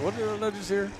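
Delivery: mock-startled, high and soft; startled reaction. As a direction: commentator retells their own confusion, startled.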